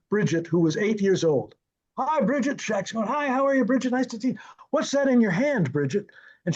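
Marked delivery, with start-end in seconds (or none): 2.0-2.6 high-pitched retold hello to a child; friendly child greeting. Shaq greeting the assistant director's young daughter, friendly hello. 3.1-6.1 higher-pitched, imitating kindly chat with a child; friendly child greeting. Shaq meeting eight-year-old on set, friendly greeting. Shaq chats with little girl.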